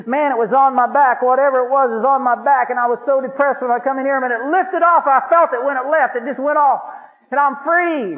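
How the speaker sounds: excited testimony. Retold or imitated. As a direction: tell it like you just got free.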